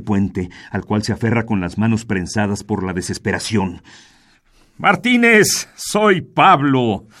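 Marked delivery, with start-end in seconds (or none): 4.8-7.1 ghostly voice. Acted. Ghost confronts Martínez.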